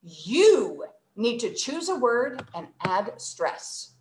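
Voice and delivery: YOU long, swooping and strong, slow sentence; stress on YOU. Shown as a demonstration. Teacher scolding a student, heavy stress on "You". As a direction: lean hard on "You" as if scolding. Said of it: "I used the word you".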